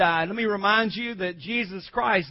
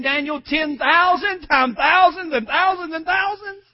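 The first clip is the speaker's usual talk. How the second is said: high, rising preacher's exultation; full-force preaching; 6 semitones higher, 6 dB louder, slower than usual. Real, not acted. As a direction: build to a loud, long-held proclamation.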